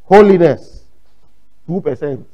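loud mock pronouncement of holiness; mocking prophetic proclamation; imitated meeting prophet. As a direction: call it out like a self-styled prophet. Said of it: "was now doing this".

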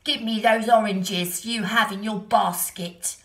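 loud bleating goat demand; bleating like a ram. A narrator's reading. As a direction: butt in like a grumpy goat. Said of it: "said the goat".

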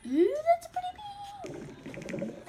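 high-pitched squeal of delight; delighted coo. Real, not acted. Reacting to a colour on a website, delighted high coo.

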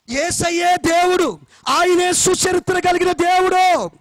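loud, slow, emphatic sermon delivery; forceful preaching. Acted. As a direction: drive each point home to the congregation. Preaching climax.

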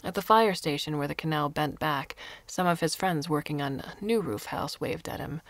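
ordinary delivery

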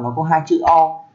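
usual speaking voice

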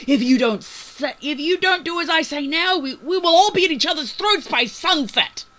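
high, frantic full-voiced shouting. A narrator's reading. Captain warns the crew to obey now, shouting. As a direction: shout a desperate warning at them. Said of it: "He just got louder".

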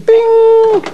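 high, loud imitated microwave ping; microwave bing. Showing viewers the microwave, a high loud mock ding. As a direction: chime it out like the microwave finishing.

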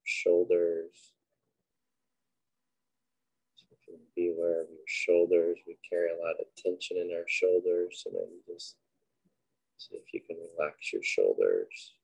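unhurried, quiet cues for breath and body; guided meditation. Acted. Leading listeners through breathing and a body scan, guided meditation.

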